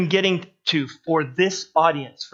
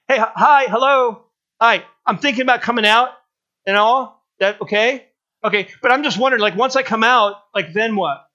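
higher, louder, clueless and chatty; dim-witted gunman; 4 semitones higher, 4 dB louder than usual. Pretend. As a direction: play a dopey guy asking for lunch mid-raid. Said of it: "imagine the stupidity of one of those guys".

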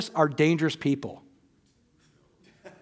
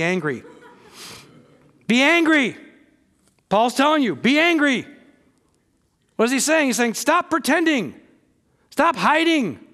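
fired-up preaching; 5 semitones higher than usual. Acted. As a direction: preach it with rising force. Preacher builds to full force.

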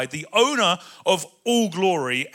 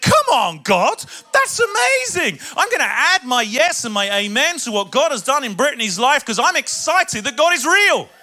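loud, high, excited praise; 7 semitones higher, 9 dB louder than usual. Real, not acted. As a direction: burst out with joy at God's work. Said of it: "can you tell I'm excited".

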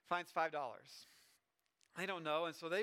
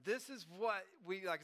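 ordinary talk, nothing marked

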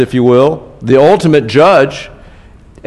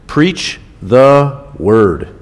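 very slow, each word weighted; slow emphasis; much slower than usual. Shown as a demonstration. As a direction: draw out preach the word. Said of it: "It's underlined in my Bible".

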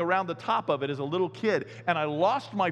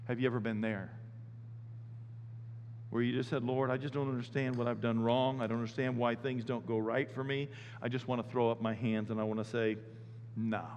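much softer and lower; hushed appeal; 6 semitones lower, 7 dB quieter than usual. Real, not acted. Pastor quietly asking his church about giving up, soft and low. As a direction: draw them in quietly. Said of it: "intimate low appeal".

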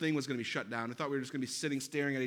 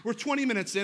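ordinary talk, nothing marked

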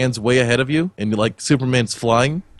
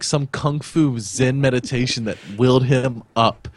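normal talking voice

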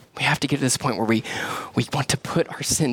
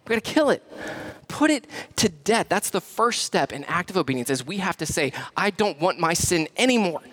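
a pastor urging the church to kill sin; preaching at full force; 9 semitones higher than usual. Acted. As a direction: drive it home to the congregation. Preaching passionately.

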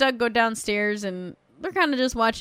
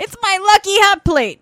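high, loud mock-proud cry; proud boast; 9 semitones higher, 7 dB louder than usual. Proud dad showing off his lucky hot plate, mock boasting. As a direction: belt it out like a prized treasure. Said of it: "he's all proud of it".

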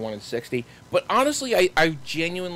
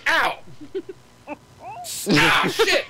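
sharp high cries of pain; pained yelps; 12 semitones higher, much slower than usual. Real, not acted. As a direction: yelp from a sudden burn. Just burned her hand.

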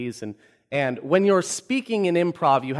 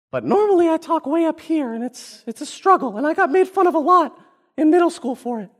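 high, squeaky put-on voice; Mickey Mouse; 12 semitones higher, 3 dB louder than usual. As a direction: talk way up high like a cartoon. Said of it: "Talking like Mickey Mouse".

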